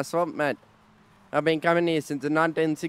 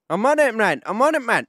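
taunting shout; 6 semitones higher, 6 dB louder than usual. Pretend. Player daring his mate to shoot him, loud taunt. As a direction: taunt loudly, mock Aussie swagger.

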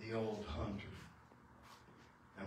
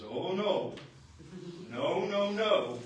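loud protest; 4 semitones higher, 6 dB louder, slower than usual. Acted. Young man refuses maiden's visits.

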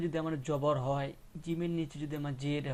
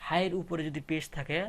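ordinary talk, nothing marked

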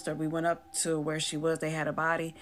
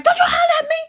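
high, full-volume indignant yell; explosive, high-pitched holler; hollering back; 13 dB louder, faster than usual. Retold or imitated. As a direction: re-enact yelling back at a sister. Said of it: "I'm hollering back".

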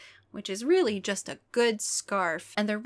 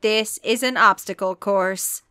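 louder, impatient booming voice; an irritated announcer, booming; 8 dB louder, slower than usual. A narrator's reading. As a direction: hurry them along from the PA. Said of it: "the voice boomed impatiently".

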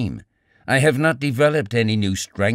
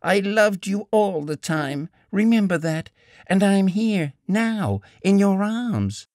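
passionate cry; 8 semitones higher than usual. A narrator's reading. Woman continues passionate plea.

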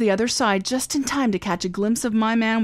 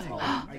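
sharp gasp; 4 semitones higher, 9 dB quieter than usual. Acted. Recognizes dead man in car.

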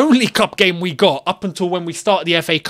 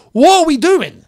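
much higher and louder, fed up; exasperated shout; 10 semitones higher, 7 dB louder than usual. Real, not acted. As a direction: yell it out in real disbelief. Frustrated fan repeats, louder.

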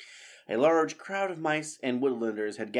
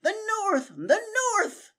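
much higher, louder triumphant shout; Methuselah shouting; 5 dB louder, slower than usual. Acted. Methuselah realising where the sword is, triumphant shout. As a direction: crow it out in triumph. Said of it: "Methuselah shouted".

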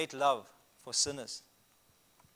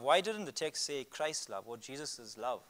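ordinary talk

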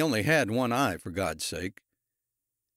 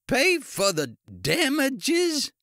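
slow, high, incredulous cry; outraged disbelief; 4 dB louder, much slower than usual. A narrator's reading. Ice cream man to officer offering payment, outraged. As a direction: draw it out high in indignant disbelief.

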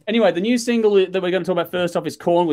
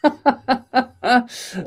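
high, sustained giggle; real, high-pitched laughing fit; 10 semitones higher than usual. Real, not acted. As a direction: burst out in a string of high chuckles. Hosts joking as stream starts.

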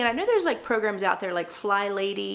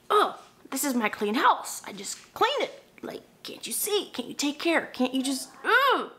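exasperated mom; 5 semitones higher than usual. Retold or imitated. Mom to kids trampling her clean house, exasperated high mock rant. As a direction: vent at the kids for messing up the clean floor. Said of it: "and I'm like".

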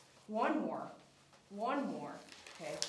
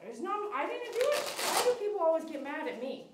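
mock-whiny protest; 6 semitones higher, 3 dB louder than usual. Real, not acted. Dog glares at groomer.

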